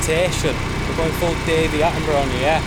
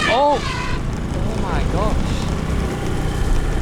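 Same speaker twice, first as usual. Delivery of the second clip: astonished; 4 semitones higher, much slower than usual. Real, not acted. Elephant passes close by.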